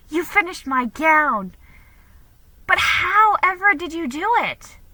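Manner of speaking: excited. Acted. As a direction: gush with joy over the gown. Delighted girl seeing her gown.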